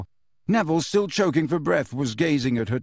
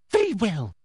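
higher-pitched prim woman's voice; agitated official; 6 semitones higher than usual. A narrator's reading. Teacher draws her wand on a pupil, agitated. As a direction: steel yourself, voice pitched up. Umbridge steels herself, wand out.

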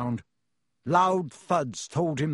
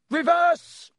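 loud, very high yell; 14 semitones higher, 7 dB louder than usual. A narrator's reading. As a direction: yell the command at the top. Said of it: "Harry yelled".

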